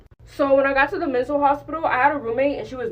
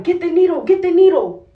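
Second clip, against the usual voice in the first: urgent shout, higher and louder; nurses shouting; 7 semitones higher, 7 dB louder than usual. Retold or imitated. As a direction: yell it like a nurse in a crisis.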